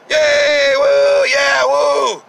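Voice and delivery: high, loud fake cheering; hammed-up applause whoops; mock crowd cheer.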